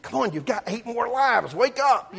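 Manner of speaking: high, loud coaxing of a dead cat; high-pitched child begging the cat to wake up; pleading with cat. Retold or imitated. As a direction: urge the cat to wake, half laughing. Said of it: "And I'm like".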